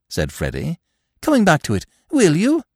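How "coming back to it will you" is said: high, breezy upper-class twit; chatty, airy young gentleman; silly young toff. A narrator's reading. Freddy pressing Eve.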